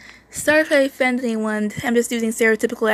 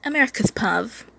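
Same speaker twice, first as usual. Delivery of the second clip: ordinary delivery, nothing marked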